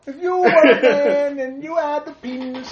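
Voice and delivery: loud mock celebrity voice with stretched words; Bill Cosby. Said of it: "Did he really talk like that?".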